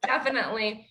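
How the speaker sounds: usual speaking voice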